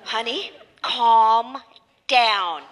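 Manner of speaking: drawn-out, patronising "calm down"; very slow, spaced-out soothing. Acted. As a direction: stretch each word to settle an angry son. Mother soothes the yelling son.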